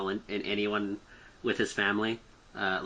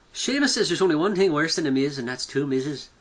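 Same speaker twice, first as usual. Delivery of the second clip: put-on lightly done Irish accent, higher; 5 semitones higher, 4 dB louder than usual. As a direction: read it with an Irish lilt. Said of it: "oh God, my Irish accent".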